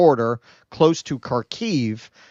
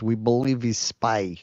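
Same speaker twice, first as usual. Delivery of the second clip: mock Russian accent; 3 dB quieter than usual. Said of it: "Please do Russian accent to help envision".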